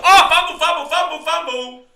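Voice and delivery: high, loud, repeated alarm; real burst at the fumble; excited fumble call. Real, not acted. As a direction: yell it as the ball comes loose. Live reaction to a fumble.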